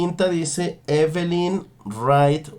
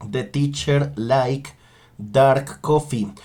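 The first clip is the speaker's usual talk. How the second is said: slow dictation; slower than usual. Shown as a demonstration. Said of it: "la pregunta que van a completar".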